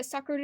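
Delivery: Sakura with the Japanese R tap repeated really fast; repeated tapped R. Shown as a demonstration. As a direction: attempt a rolled R by stuttering taps on Sakura. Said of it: "Just do it on repeat really fast".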